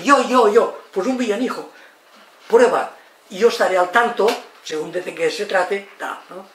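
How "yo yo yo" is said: put-on higher, louder excited little kid; eager child. Child begging to try it alone, eager. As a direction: pipe up loudly like an eager child. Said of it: "Y el niño dice".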